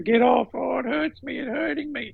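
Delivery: high-pitched put-on cry of pain; bunging it on. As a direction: whine like a mum faking agony. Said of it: "She's just bunging it on".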